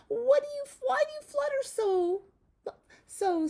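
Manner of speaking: very high, loud, crying lamb; high wailing lamb; tearful lamb. Acted. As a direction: plead like a little lamb near tears. Lamb pleading in a read-aloud.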